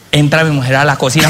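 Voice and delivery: ordinary talk, nothing marked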